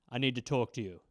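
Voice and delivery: low, soft serious father; stern dad. Retold or imitated. Dad pulling his son aside, low and soft. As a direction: drop low like a dad about to lecture.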